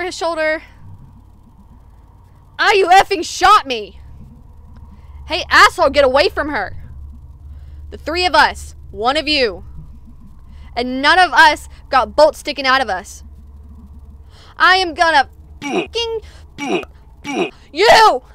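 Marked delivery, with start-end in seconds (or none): 2.6-4.0 loud, high yell of pain and outrage; pained angry shout. Acted. Player character just got shot. 5.3-6.8 loud warning yell; furious shout. Acted. Defending friend from attacker. 7.9-13.2 loud, hard warning; loud threat. Acted. Threatening the cultists. 14.6-18.2 loud, high furious yell; enraged scream. Acted. Raging at the attacker.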